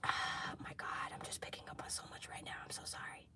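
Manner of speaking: sighing whisper, very soft; overwhelmed whisper. Real, not acted. Reader whispering overwhelmed apology to viewers. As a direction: hush it as if overwhelmed.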